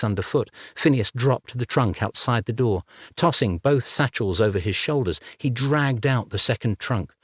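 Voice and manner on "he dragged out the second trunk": ordinary delivery, nothing marked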